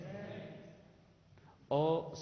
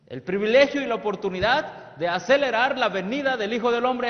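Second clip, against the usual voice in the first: preaching; 6 semitones higher, 8 dB louder than usual. Acted. Preacher proclaiming a prophetic quote to the youth, loudly. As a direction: proclaim it to the whole church.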